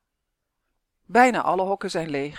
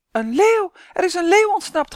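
high loud panicked shriek; 12 semitones higher, 9 dB louder than usual. Acted. Woman at the zoo spotting an escaped lion, shrieking. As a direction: scream the alarm at full pitch. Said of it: "gilt de mevrouw".

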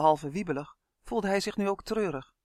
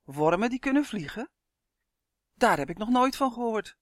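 higher, incredulous exclaiming; astonished; 5 semitones higher, 4 dB louder than usual. A narrator's reading. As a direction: react in amazed disbelief. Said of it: "riep Justin verbaasd". (verbaasd en ongelovig)

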